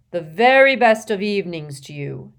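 loud, expansive greeting with a broad smile; headmaster's booming, genial welcome; beaming welcome. A narrator's reading. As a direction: greet a whole hall heartily. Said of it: "smiling broadly".

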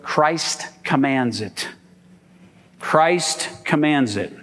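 "Christ" stressed, said slowly; slow emphatic point. Shown as a demonstration. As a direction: lay out the sermon point word by word. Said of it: "Here's the second one".

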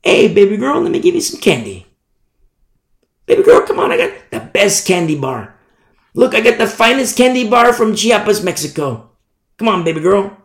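high, coaxing street-peddler voice; Satan as tempter. Acted. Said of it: "And so what does he do?".

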